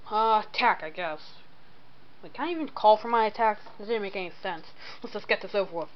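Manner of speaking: ordinary delivery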